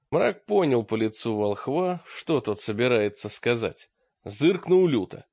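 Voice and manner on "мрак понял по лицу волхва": ordinary delivery, nothing marked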